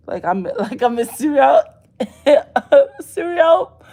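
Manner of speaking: laughing through the words; cracking up while saying serial. Real, not acted. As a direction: breaks into a loud laugh mid-phrase. Laughing at her own joke.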